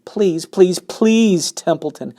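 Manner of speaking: high, loud desperate pleading; begging. A narrator's reading. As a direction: beg him desperately. Wilbur begging the rat.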